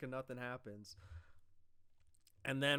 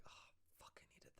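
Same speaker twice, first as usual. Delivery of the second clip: whispered "I needed that"; relieved whisper of the craving; whispered relief; 26 dB quieter, much faster than usual. Retold or imitated. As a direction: breathe it out in relief. Roman's relieved reaction.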